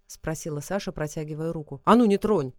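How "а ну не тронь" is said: loud sharp warning; angry. Acted. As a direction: snap at her to keep off. Kalidar snaps at Sasha.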